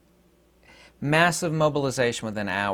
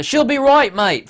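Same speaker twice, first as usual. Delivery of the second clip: a hammed-up Australian accent, higher and louder; butchered Australian accent; 13 semitones higher, 8 dB louder than usual. Vlogger mimics his Sydney buddy in a butchered Australian accent. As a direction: play a bad Aussie mimic with a loud, lifted Australian accent. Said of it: "I'm gonna make an Australian accent that I'm gonna butcher".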